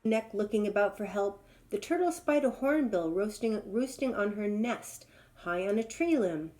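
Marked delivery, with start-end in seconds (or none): none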